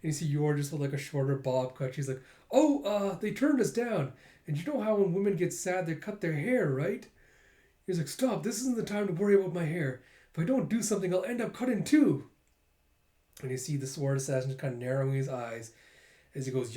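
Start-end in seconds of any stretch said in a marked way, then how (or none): 2.5-7.1 high-pitched, put-on character voice; flustered excuse. Yor explains her new haircut to Lloyd, flustered. 8.1-12.3 high, urgent character voice; panicked self-scolding. Acted. Yor scolds herself while dodging the sword, panicked.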